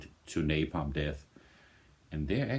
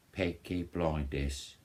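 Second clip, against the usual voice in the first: playful, slow Birmingham accent, not authentic; slow mock Birmingham accent on "Peaky Blinders"; goofy Birmingham accent; slower than usual. Shown as a demonstration. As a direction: put on a Brummie accent just for fun. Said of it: "trying to do a authentic accent".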